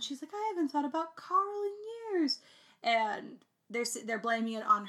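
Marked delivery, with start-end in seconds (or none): 0.3-2.4 high, mock-posh British delivery; a bad British accent, pitched up; hammed-up British accent; crappy British accent. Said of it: "I do it in the most crappy British accent".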